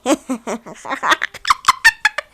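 wicked witch laugh; witch cackle. Pretend. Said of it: "cadı gülüşü yaptım az önce".

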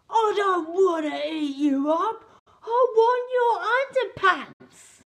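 loud, high roaring dinosaur shout; roaring T-Rex. Acted. Narrator voicing T-Rex demanding underpants, roaring shout. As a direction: roar it like a greedy T-Rex. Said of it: "he roared with deafening rants".

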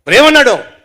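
high, loud demand; sharp shouted interrogation; angry questioning. Acted. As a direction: fire the question at him.

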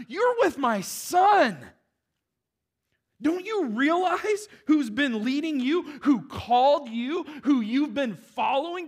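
high, loud, urgent rebuke; God scolding Peter. Retold or imitated. God rebuking Peter for his shelter talk, loud and urgent. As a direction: shake Peter by the shoulders with words. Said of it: "and God's just like".

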